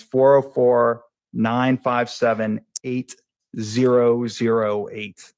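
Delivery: slow, separated digits with pauses. Real, not acted. Witness giving a number for the court reporter, slowed down. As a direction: read the number out slowly, group by group. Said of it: "so try to slow down".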